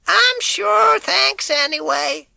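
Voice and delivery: put-on high, slow old-lady call; in her voice. Boy calling to the milkman in the old lady's voice. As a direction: put on the old woman's voice to fool him. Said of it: "in her voice, in her accent".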